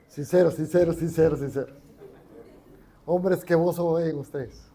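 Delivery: ordinary delivery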